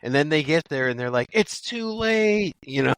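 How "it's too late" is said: high, drawn-out mock wail; mock lament. Voicing latecomers at the battle, mock lament. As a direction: stretch it out high and slow. Voices the trees arriving too late.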